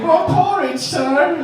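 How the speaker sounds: high, put-on Victorian orphan; Dickensian orphan voice. Barefoot husband as a Dickens orphan, high hammed-up squeak. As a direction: squeak it like a street urchin.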